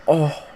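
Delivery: high squealed blowfish "Oh!"; puffed-up pufferfish noise. Retold or imitated. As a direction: puff your cheeks and squeak "Oh!". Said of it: "make the blowfish noise".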